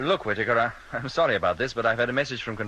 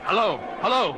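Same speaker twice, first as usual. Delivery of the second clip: high, raised double call; urgent calling; 9 semitones higher, 4 dB louder than usual. Acted. Captain trying to rouse crewman, urgent calling. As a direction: call sharply down the line.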